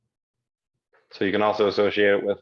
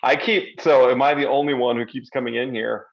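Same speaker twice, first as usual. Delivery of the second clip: normal talking voice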